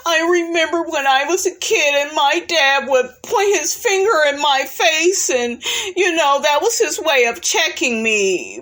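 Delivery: a hammed-up sob story; mock fake crying. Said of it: "begins crying with fake tears and says".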